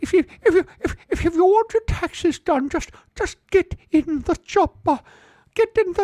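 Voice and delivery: squeaky accountant from Phoenix; accountant impression. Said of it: "he sounds like this".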